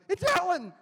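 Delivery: high, loud excited cry; excited shout. Retold or imitated. Fan at Cleveland Pride shouting, high excited cry. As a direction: squeal like a fan spotting a star.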